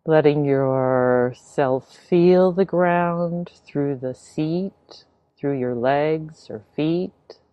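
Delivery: unhurried meditation guidance with long pauses; spacious, measured instructions, leaving silence between phrases; guided meditation. Real, not acted. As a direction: ease listeners gently into the practice. Begins leading a guided meditation.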